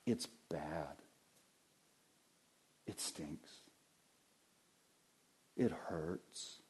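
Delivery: low, hushed and pained; soft, heavy-hearted words; quiet grieving Jesus. Acted. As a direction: speak as Jesus in quiet grief. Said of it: "he's saying".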